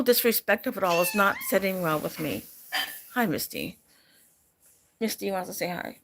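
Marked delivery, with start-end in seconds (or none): 3.1-6.0 gentle, soft voice for the cat; soft cat talk. Real, not acted. Owner greets her cat Misty in soft, gentle talk. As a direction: coo a hello to the pet.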